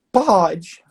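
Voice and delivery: put-on half-hearted yes from a shy kid; reluctant kid. Coach retells the boy's reluctant yes. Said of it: "Daquele jeito".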